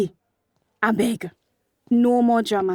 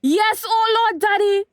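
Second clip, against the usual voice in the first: anguished cry; 11 semitones higher, 4 dB louder than usual. Acted. Daughter cries out in distress.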